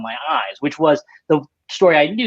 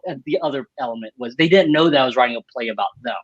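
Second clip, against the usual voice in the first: ordinary talk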